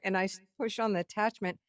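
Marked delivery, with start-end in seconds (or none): none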